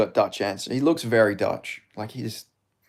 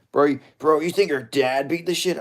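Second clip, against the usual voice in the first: Strickland; 3 semitones higher than usual. Acted. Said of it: "I was doing Strickland's way".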